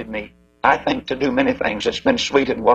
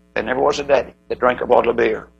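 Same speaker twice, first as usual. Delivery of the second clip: normal talking voice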